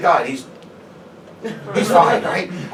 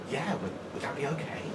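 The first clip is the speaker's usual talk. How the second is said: much softer timid asking; meek request; 5 semitones lower, 14 dB quieter than usual. Retold or imitated. Shop worker timidly asking the man to leave, soft. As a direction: ask sheepishly, not alpha at all.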